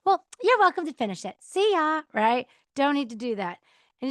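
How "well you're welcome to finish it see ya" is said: quick, high, dismissive sarcasm; mock-sweet brush-off, pitched up; snotty, sarcastic. Shown as a demonstration. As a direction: toss it off snottily. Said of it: "don't do it in a snotty, sarcastic way".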